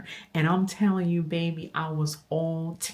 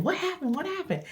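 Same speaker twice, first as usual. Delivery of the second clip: high, urgent, startled repeat; imitating a panicked son asking what's wrong; alarmed son; 13 semitones higher, 3 dB quieter than usual. Son hearing his mother scream, high alarmed questions. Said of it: "My son's like".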